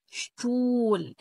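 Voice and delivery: German word demo; German sht sound. Shown as a demonstration. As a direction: stretch شتول for the class. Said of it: "اس تي، شتا،".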